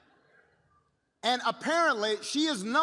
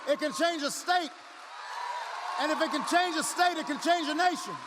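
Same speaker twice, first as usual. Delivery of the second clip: high, forceful climax over cheers; impassioned stump-speech crescendo; rousing rally oratory; 6 semitones higher than usual. Real, not acted. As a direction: build it step by step for the crowd.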